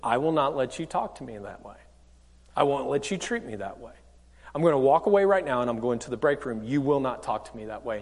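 low, soft and controlled; composed boundary-setting. Acted. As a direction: quietly hold the line after a breath. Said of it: "Take a breath, and then you say".

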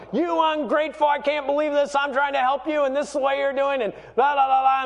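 high-pitched exasperated parent tirade; mock yelling rant. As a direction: act out a parent going off on the kids. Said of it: "I started yelling at them and going off".

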